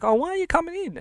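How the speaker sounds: put-on high, eager little kid voice; kid's question. Kids asking mum at the fence, high childlike voice. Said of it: "we'll just be like".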